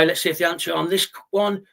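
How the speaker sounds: usual speaking voice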